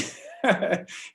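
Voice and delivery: short airy laugh with no voice; breathy laugh. Real, not acted. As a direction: let out a quick silent chuckle. Laughs about Data not human.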